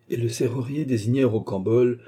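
ordinary delivery

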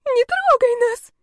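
high, loud, drawn-out squeal; hysterical shriek. A narrator's reading. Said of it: "истерили девицы".

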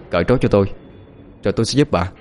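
usual speaking voice

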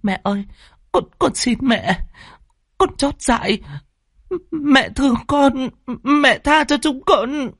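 high, faltering plea; frightened daughter's tearful begging; panicked pleading. Acted. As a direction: beg mother for mercy, slowing as it goes. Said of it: "cô Tâm hốt hoảng".

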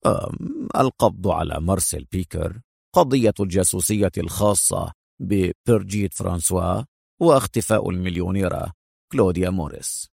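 low, indifferent listing; nonchalant. A narrator's reading. Agent predicting headlines for his partner, low and nonchalant. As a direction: reel off headlines as if unimpressed. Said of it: "في لا مبالاة وهو يقول".

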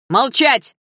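teacher yells for silence; furious command. Acted. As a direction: slam it out with a fist on the desk. Teacher bangs fist, shouts.

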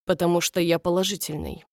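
ordinary delivery, nothing marked

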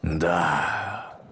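low, drawn-out solemn voice; booming voice. A narrator's reading. Voice behind the girls answers, booming. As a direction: intone it like a great lion. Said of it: "прогремел голос за их спинами".

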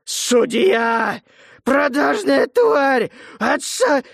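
furious convict's roar. Acted. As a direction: bellow with rage at the judge. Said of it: "заревел".

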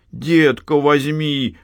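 put-on high begging child voice; pestering child. A narrator's reading. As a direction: whine it like a pleading boy. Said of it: "клянчил Артёмка".

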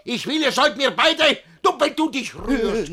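high, loud, breaking off mid-order; sputtering rage. Acted. Master too angry to finish his order, high and loud. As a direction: lose the thread in fury.

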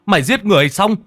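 high, loud, rushed accusing voice; angry accusation. Acted. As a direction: fling the accusation fast in his face. Accusing villager points at him.

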